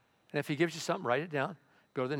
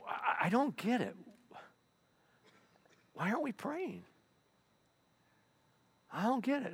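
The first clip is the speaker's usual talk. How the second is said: puzzled voice; 9 semitones higher, 4 dB quieter than usual. Acted. Voicing someone puzzled about prayer.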